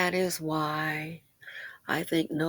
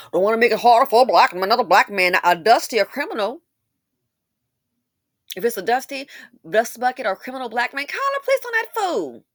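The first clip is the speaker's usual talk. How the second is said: mock outrage; 3 semitones higher, 8 dB louder than usual. Mock voice of community logic.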